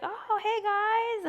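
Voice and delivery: high, very slow easygoing hello; drawn-out friendly hi to the pets; father greeting. Retold or imitated. Late father greeting her pets, slow and high. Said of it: "And he's like".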